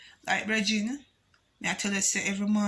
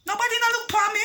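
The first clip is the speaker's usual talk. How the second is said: high, loud indignant outburst; outraged cry; 13 semitones higher, 4 dB louder, much faster than usual. Real, not acted. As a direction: cry it out, stunned nobody came. Outraged retelling of neglect.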